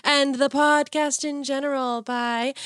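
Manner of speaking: high, slow, eerie outro voice; hammed-up ghostly announcer reading the sign-off; spooky ghost host. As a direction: croon to the little phantoms, playfully haunting.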